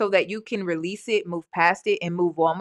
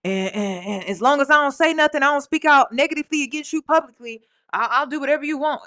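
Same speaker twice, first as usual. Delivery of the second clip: higher, lightly mocking employee voice; eager-to-please employee; 4 semitones higher than usual. Host mimicking the mom as a bought-off employee, mocking. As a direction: play the paid helper going along with it. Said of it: "she sound more like an employee".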